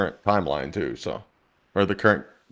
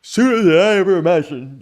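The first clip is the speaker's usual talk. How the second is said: rising, louder gloating villain; booming echoing villain monologue; 5 semitones higher, 4 dB louder than usual. Acted. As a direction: swell into a grand evil boast. Villain boasts of empire.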